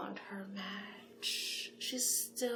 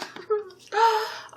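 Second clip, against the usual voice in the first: delighted giggle; 13 semitones higher, 13 dB louder than usual. Acted. Delighted matchmaker laughs.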